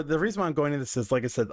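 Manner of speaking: usual speaking voice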